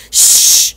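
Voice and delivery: sharp loud shush. Acted. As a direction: hush them again, urgently. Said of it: "said Hagrid again".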